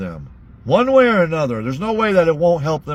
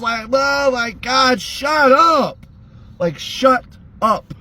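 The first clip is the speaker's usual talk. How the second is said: fed up; 8 semitones higher, slower than usual. Real, not acted. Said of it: "exasperated at Don".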